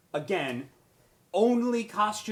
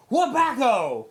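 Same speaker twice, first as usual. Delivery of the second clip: mock punch sound effect; 8 semitones higher, 11 dB louder, slower than usual. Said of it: "And then went".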